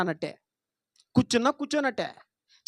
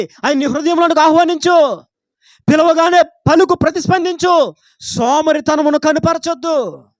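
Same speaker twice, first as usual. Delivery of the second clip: raised, pleading call to respond; urgent preaching; 4 semitones higher, 9 dB louder than usual. Acted. Preacher urging the congregation to answer God, raised and pleading. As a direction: press the listeners to open their hearts now.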